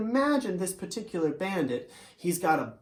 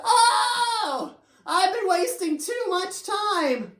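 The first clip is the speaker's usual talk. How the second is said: discouraged; 9 semitones higher than usual. Acted. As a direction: wail it out, half laughing in despair. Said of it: "he was very discouraged".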